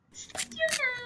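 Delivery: a hint of a let-down helper; lightly voiced sigh of failure; deflated character. Frustrated art supply.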